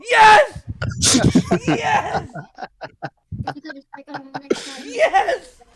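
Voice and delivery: high exultant shout; ecstatic cheer. Real, not acted. Seeing the friend's picture arrive, ecstatic high cheering. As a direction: cry out in real, laughing delight.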